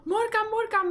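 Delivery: llama a Morkan a voz en grito, agudo; llamando fuerte. Real, not acted. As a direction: grita su nombre para que venga. Calling the Sim excitedly. (llamada fuerte y aguda al personaje)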